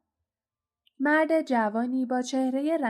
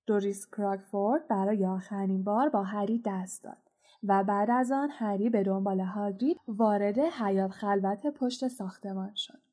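plain reading voice

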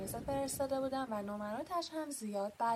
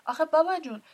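incredulous; 7 semitones higher, 13 dB louder, faster than usual. Acted. Hagrid exclaims in disbelief.

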